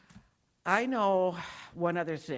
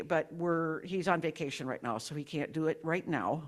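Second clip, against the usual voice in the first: ordinary delivery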